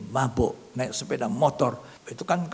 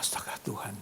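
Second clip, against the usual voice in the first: whispered gasp; 4 semitones lower, 7 dB quieter than usual. Retold or imitated. Alarmed aside while sprayed.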